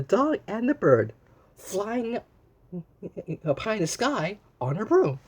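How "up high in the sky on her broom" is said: ordinary delivery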